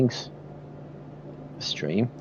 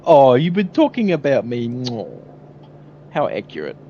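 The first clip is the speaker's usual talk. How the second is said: mustache twirling villain; 3 semitones higher, 8 dB louder than usual. Acted. Continues villain bit.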